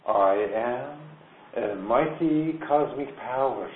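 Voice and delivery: solemn affirmation. Acted. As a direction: proclaim it like a mantra. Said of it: "she says".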